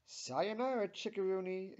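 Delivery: high, loud, gleeful farewell; happy exclamation. A narrator's reading. Jean bids Tammy goodbye, exclaiming happily. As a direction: call it out with a flourish. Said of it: "exclaims Jean happily".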